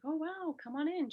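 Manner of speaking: a hint of the stepmother's happy surprise at the door; surprised welcome. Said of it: "Dot was like".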